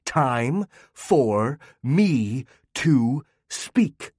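each word hammered out slowly; very slow jabbing. A narrator's reading. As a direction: jab every word at her. Repeats slower, each word jabbed.

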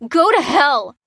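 angry yell. A narrator's reading. The woman yells at the soldier, furious. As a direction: hurl it at the soldier. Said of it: "yelled the woman".

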